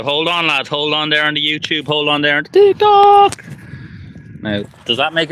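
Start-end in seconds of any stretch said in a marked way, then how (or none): 1.9-3.4 squeaky high, slow and loud on TikTok; high falsetto call. Acted. Asking TikTok viewers to hold on, in a high falsetto. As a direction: pipe up like a jingle. Repeating the line for each platform.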